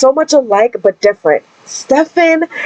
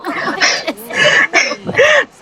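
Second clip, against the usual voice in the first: shrieking giggle, pitched way up; high squealing laugh; 10 semitones higher, 4 dB quieter than usual. Real, not acted. Gasping at an in-game surprise.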